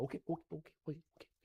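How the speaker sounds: soft, hurried backing down; meek surrender. Retold or imitated. Noah gives in as rain starts, meek and soft. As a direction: give in quietly, repeating yourself.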